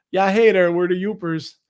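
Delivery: loud mock Yooper accent. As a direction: call it out like an Upper Peninsula local. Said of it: "people that live in the Upper Peninsula".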